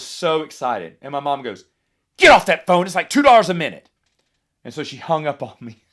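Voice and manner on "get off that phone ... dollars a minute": put-on much louder, a mom telling her kid off; scolding mom. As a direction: bark it like a mom cutting off a costly call. Mom scolding over expensive call.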